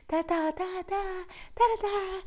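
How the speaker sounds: high da-da sting of soap music; mock dramatic music. Imitating the American soap's dramatic music. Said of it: "And then you hear the music".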